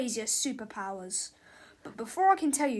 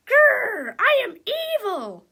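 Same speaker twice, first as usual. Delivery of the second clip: loud, high growling declaration; hammed-up villain shout; evil villain; 12 semitones higher, 10 dB louder, slower than usual. A narrator's reading. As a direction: growl and proclaim it like a cartoon villain. Comic villain declares herself.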